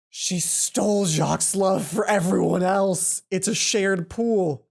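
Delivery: loud, slow, indignant; appalled outburst; outraged. Real, not acted. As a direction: spell out the betrayal loudly and slowly. Outraged realisation about stolen love.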